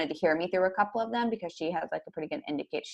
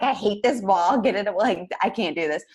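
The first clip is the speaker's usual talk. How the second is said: freaking out; 3 semitones higher, 4 dB louder than usual. Retold or imitated. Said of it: "freaking out".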